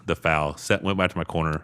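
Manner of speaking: ordinary talk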